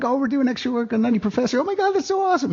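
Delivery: high gushing brag; mock gushing. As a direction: squeal it like a starstruck fan. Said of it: "we're like".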